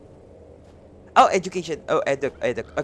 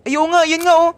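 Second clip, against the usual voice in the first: high, loud "oh right!"; excited loud exclamation; excited realisation; 12 semitones higher, 9 dB louder than usual. Real, not acted. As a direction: burst out as it clicks. Sudden realisation on stream.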